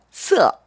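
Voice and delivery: 서 starting on 스 and ending on 어, falling; 스-to-어 glide. Shown as a demonstration. Teacher correcting the learner's last syllable, pronunciation demo. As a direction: slide from 스 into 어 with a sharp drop. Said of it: "스에서 시작해서 어로 끝나주시면 돼요".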